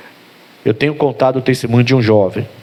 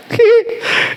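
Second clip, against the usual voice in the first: high burst of laughter; laughing. Real, not acted. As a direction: break into a real laugh. Preacher laughs at the story.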